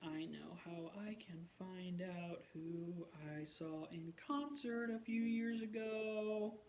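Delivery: slow, soft, drawn-out words; murmuring to oneself. Real, not acted. Vlogger looking up a forgotten singer, slow absent murmur. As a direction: think aloud softly while busy searching. Murmuring to self while searching.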